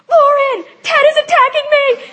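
high, loud mock cry for help; panicked calling out. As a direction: yell for your roommate in hammed-up alarm. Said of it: "was sprinting upstairs, I was like".

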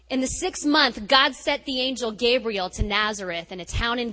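loud, projected Bible reading with voiced angel; scripture reading. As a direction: proclaim the passage to the congregation. Reads Annunciation scripture aloud.

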